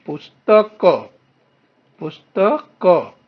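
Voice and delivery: "पुस्तक" loudly, final "क" sounded out fully; syllable-by-syllable pronunciation. Shown as a demonstration. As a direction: punch out each syllable of "पुस्तक". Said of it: "उच्चारण है बच्चों".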